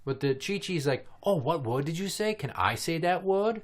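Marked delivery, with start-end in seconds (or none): 1.2-3.6 put-on high, eager little-kid voice; imitated curious child. Daughter asking to try a new word, higher child voice. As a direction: mimic a small child asking, rising at the end.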